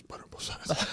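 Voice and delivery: hushed, voiceless whisper; whispered prompt. Retold or imitated. Friend secretly prompting him while ordering, whispered. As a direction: hiss it discreetly as a sneaky prompt.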